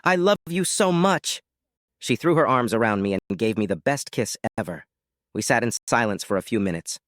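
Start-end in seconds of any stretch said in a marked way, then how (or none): 0.0-1.4 screamed with joy. A narrator's reading. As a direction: gush it slowly, overjoyed. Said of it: "screamed with joy".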